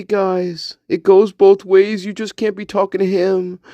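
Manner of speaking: steady high-pitched character voice; chatty spirit guide. Pretend. Spirit guide tells how they panicked, high chatty voice. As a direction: complain in a high comic sidekick voice.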